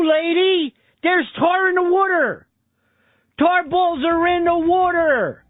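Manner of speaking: put-on high, whiny Philadelphia accent talking down to a confused dispatcher; exasperated Philly accent. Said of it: "that awful accent".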